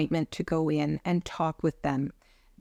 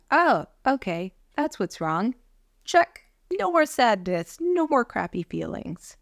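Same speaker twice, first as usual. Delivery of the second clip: mock breezy dismissal; 5 semitones higher than usual.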